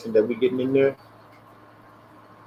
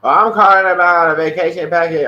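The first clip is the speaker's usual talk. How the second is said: rude caller imitation; 4 semitones higher, 8 dB louder than usual. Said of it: "be rude and say".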